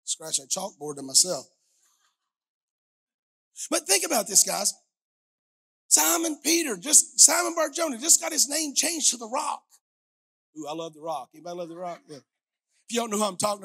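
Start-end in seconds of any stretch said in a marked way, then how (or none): 3.7-9.6 louder, higher fervent sermon delivery; full-force preaching. Acted. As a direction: preach it out to the congregation. Preaching climax.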